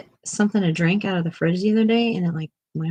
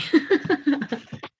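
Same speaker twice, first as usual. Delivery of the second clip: high falling laugh; 10 semitones higher than usual. Real, not acted. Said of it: "Oh, how funny".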